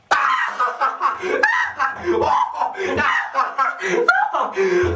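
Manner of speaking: long, high cackle; maniacal laugh. Acted. Madman teasing the doctor with no answer, maniacal cackle.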